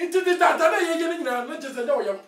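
usual speaking voice

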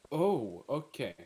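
loud sudden oh of discovery; surprised realisation. Real, not acted. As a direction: it suddenly clicks, blurt it out.

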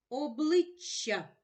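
«Обличчя» повільно, голосніше; читає «Обличчя» розтягнуто; slow reading. Shown as a demonstration. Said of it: "Фіолетове слово". (повільне читання по складах)

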